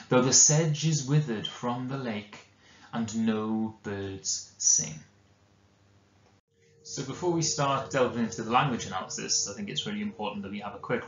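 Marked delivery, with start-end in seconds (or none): 0.1-5.0 slow, solemn reading of the ballad; poem recitation. Acted. Teacher reading Keats's ballad to the class, slow and solemn. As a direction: linger on each line like a dark tale.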